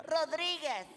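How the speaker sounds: lightly voiced high dancer. Dancer gives surname, acted.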